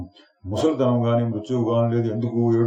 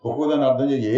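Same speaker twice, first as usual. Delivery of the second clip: ordinary talk, nothing marked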